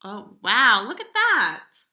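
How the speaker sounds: ordinary delivery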